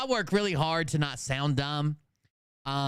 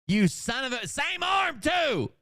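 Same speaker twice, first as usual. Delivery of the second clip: shocked outburst at a copied tattoo; outraged shout; 11 semitones higher, slower than usual. Real, not acted. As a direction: yell it out in disbelief.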